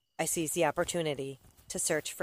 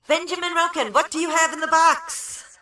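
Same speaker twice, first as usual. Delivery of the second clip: very high, loud ring-announcer bellow; full-volume shout with held-out words; wrestling announcer shout; 13 semitones higher, 10 dB louder than usual. A narrator's reading. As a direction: announce it to the whole arena. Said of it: "I shout like a wrestling announcer".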